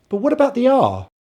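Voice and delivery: normal talking voice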